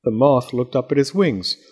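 plain reading voice

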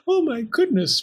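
high, gasping distress; sobbed. A narrator's reading. Pearl learning of the knife, sobbing. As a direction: gasp and cry it up high. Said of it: "Pearl sobbed".

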